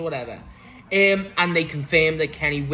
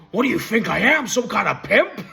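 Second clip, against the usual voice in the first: higher, louder imitation of an affronted wrestler; mock indignant quote; 5 semitones higher, 4 dB louder than usual. Wrestler answering Max about his family, mock indignant. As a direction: throw the question out in mock outrage. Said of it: "It's the way he said it".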